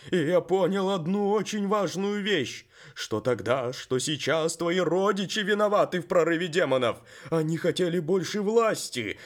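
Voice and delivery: loud, high, grave revelation; talking horse. Acted. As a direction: reveal a terrible truth. The horse reveals the truth. (голос коня, мрачное откровение)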